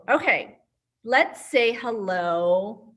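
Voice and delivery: ordinary talk, nothing marked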